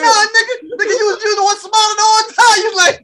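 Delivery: high, loud, fast laughing retort; laughing protest. Real, not acted. He fires back at his friend, laughing and loud. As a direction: laugh while accusing him.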